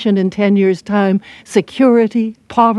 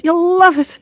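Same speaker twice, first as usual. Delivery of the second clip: very high Irish lilt, leaning on love; delighted Irish repeat; 10 semitones higher, 3 dB louder than usual. Acted. Check-in girl assures the traveller, delighted Irish voice. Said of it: "her face beamed delight".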